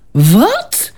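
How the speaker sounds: high, loud shocked "Wat?"; dismayed cry. A narrator's reading. Knight stunned by the refusal, a loud shocked cry. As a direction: blurt it out, stunned. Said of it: "riep ridder Robert onthutst".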